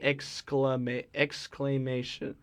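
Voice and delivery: "exclamation" spelled out slowly, syllable by syllable; slow careful pronunciation. Shown as a demonstration. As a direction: sound out "exclamation" carefully, with "eks" first. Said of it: "how would you say it?".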